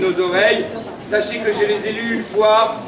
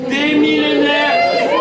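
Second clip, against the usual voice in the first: slow ringing shout; town crier; 6 semitones higher, 4 dB louder, much slower than usual. Acted. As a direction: call it out to the square. Crowd proclamation, shouted.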